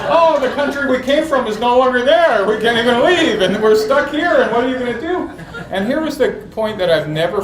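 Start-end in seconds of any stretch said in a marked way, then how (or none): 0.1-5.3 much higher and louder, laughing; frantic mother rambling, hammed up; mock-panicked mom voice; imitating mom. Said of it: "that's what she sounded like".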